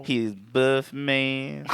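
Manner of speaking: very slow drawn-out joke voice; hammed-up slow punchline; slow goofy voice. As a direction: stretch it out like a silly announcer.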